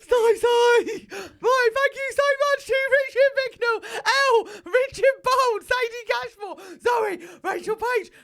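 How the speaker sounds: very high, loud squeal. Pretend. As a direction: shriek the names in a hammed-up impression.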